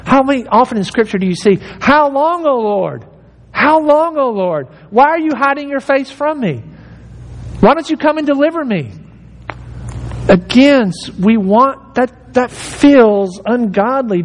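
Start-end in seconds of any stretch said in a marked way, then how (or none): 1.8-8.9 high, pleading psalm cry; lament. Retold or imitated. Psalmist crying to God, a touch of lament.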